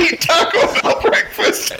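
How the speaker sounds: talking through laughter; cracking up while reciting a mock-solemn quote; laughing. Real, not acted. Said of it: "I'm gonna puke laughing about this".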